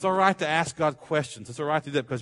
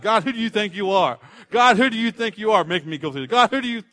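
higher-pitched, indignant demand; challenging, questioning God; 6 semitones higher, 4 dB louder than usual. Acted. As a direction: confront God as if calling him to account. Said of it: "I'm not talking about questioning God".

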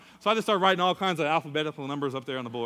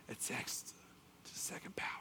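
very soft, secretive whisper; hushed imitation of a teacher's whisper; whispered answer; 3 semitones lower, 19 dB quieter than usual. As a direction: slip the answer to a student unheard. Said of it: "he whispered to me the answer".

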